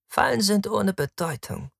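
high-pitched haughty woman; impulsive, cruel woman, pitched high; scornful Victra, dismissive. Acted. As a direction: brush it aside in a high woman's voice. Narrator voices cruel Victra.

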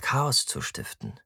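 ordinary delivery, nothing marked

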